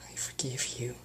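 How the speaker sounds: soft, breathy, hurting whisper; pained whisper. Acted. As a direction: whisper through the pain. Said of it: "she whispered in pain".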